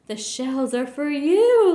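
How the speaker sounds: loud, slow gloating laugh-line. A narrator's reading. Quuggerbug tricking Horton, a loud slow gloating laugh. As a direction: gloat over him. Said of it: "laughed the bug".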